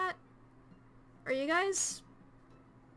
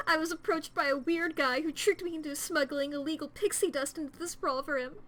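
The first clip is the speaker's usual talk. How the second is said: normal talking voice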